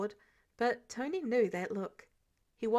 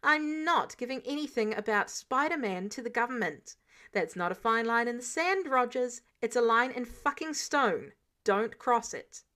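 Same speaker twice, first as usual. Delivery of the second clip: louder, hard-edged refusal, slowing on the last words; angry warning; 5 dB louder than usual. Acted. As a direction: lay down the line, no room to argue. Tony snaps at Steve.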